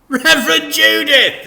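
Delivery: high, loud, hearty greeting; loud cheery dad welcome; cheerful announcement. Acted. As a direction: announce her like an old friend. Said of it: "he announced cheerfully".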